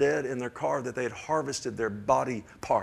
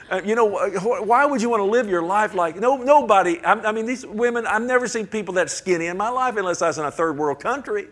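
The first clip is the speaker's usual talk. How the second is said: much higher and louder, worked up; heated rant; 8 semitones higher, 7 dB louder than usual. Real, not acted. Preacher railing at skinny mall fashion, high and loud. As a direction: let the outrage spill over. Ranting about skinny women.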